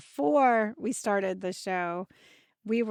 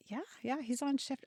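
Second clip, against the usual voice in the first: softer, teasing answer; quiet quoted reply; 3 semitones higher, 10 dB quieter than usual. Retold or imitated. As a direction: say it hushed, like an inside joke. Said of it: "I would be like".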